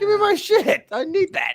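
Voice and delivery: demanding shout. Real, not acted. Demanding mouse back.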